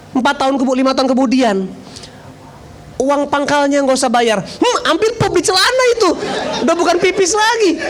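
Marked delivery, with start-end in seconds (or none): 4.4-7.8 high-pitched, hammed-up amazement; comic retold shock. Preacher tells congregation of waived entrance fee, hammed-up shock. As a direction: exaggerate the astonishment for laughs.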